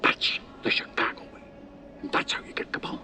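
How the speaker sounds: hushed conclusion. Acted. Veteran cop closing his lesson on Capone, whispered. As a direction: lean in and whisper it.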